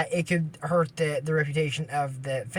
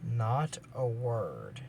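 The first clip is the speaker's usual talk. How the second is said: slow, soft, low warning; hushed; 8 semitones lower, 9 dB quieter, much slower than usual. Retold or imitated. Annie hushing Curran, slow and soft. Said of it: "and she's like".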